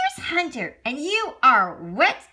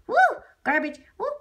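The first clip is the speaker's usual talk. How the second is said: ordinary delivery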